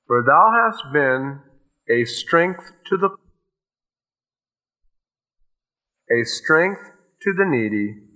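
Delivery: slow, measured reading of the verse; preacher reading Isaiah slowly to the flock; scripture reading. Acted. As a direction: draw each phrase out like a solemn psalm.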